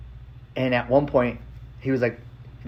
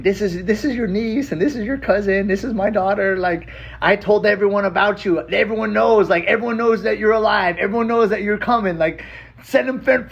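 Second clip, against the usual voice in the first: higher, eager auntie voice, lightly done; imitating auntie; 8 semitones higher than usual. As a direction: read her texts like an excited auntie. Aunt's texts read out to nephew.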